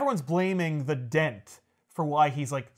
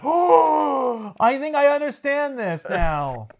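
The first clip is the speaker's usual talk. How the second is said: put-on higher, slow childlike awe; naive kid; 8 semitones higher, 4 dB louder, much slower than usual. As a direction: say it like an earnest kid. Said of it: "you're like".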